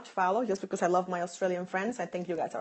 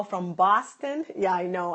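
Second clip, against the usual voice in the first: ordinary talk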